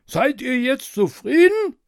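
high, loud reproachful king; reproachful tyrant king. A narrator's reading. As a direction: scold the court sourly. King voiced by narrator.

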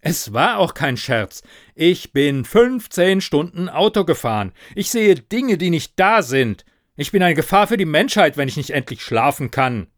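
exasperated outburst. Acted. Sleepless driver snaps back at ghost, exasperated. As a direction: rant it, building in pitch and volume.

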